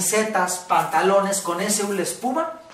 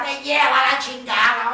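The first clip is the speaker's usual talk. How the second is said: shouted curse; 4 semitones higher, 3 dB louder than usual. Shown as a demonstration. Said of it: "Si le quieres poner una palabra".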